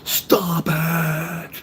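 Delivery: drawn-out, high yell of exasperation; retold scream. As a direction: re-enact screaming it across a store. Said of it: "I screamed as loud as I could".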